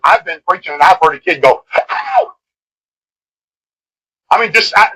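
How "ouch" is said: sudden, much higher yelp of pain; kid's yelp. Retold or imitated. Pinched kid crying out in pain during the sermon. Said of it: "just scream out loud".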